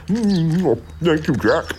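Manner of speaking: high, muffled with relish; eating robin. A narrator's reading. Robin helping himself to birdseed, savouring it. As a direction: talk through a beakful of seed.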